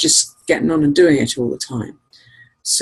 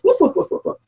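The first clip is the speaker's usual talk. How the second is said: dog barking; faster than usual. Retold or imitated. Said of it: "He goes".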